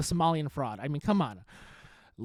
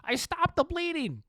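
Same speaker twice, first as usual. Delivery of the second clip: retold yell; 10 semitones higher than usual. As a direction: call it out like a medic on the beach, a hammed-up cry. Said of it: "He yells out there".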